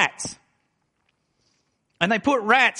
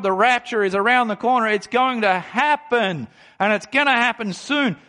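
raised, urgent preacher's voice, lifted high; fervent preaching; 4 semitones higher than usual. Acted. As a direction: preach the coming rapture with rising conviction. Raised preaching run on rapture hope.

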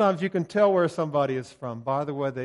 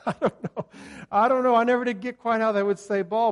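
loud, high-pitched amused admission; real laughter spilling into words; laughing; 8 semitones higher, 7 dB louder, faster than usual. Real, not acted. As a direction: laugh through it, voice lifted. Laughing at own failed imitation.

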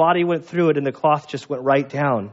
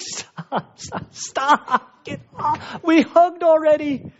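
annoyed son; 13 semitones higher, much slower than usual. Retold or imitated. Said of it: "It's like".

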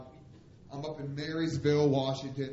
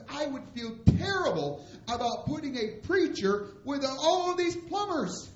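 distressed protest; 10 semitones higher than usual. Retold or imitated. Boss explains refusal to a preacher, distressed protest. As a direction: imitate a boss's appalled objection, pitch climbing.

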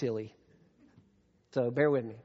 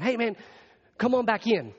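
called out higher and louder across the lot; calling out; 8 semitones higher, 6 dB louder than usual. Retold or imitated. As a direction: hail him back from the door. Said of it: "running out the door going".

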